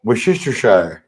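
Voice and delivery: Worcestershire said as "wish-ister-shire"; American Worcestershire; American pronunciation. Shown as a demonstration. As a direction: give the full American Worcestershire. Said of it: "how do you say it?".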